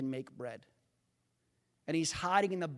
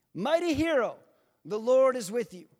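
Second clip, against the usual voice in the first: bold, ringing angelic greeting; proclamation; 4 semitones higher, 6 dB louder than usual. Acted. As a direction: proclaim it louder and higher. Preacher proclaims angel's greeting again.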